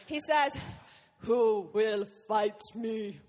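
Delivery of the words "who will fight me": put-on slow, low Russian-accented giant taunt. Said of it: "in a kind of Russian-y accent".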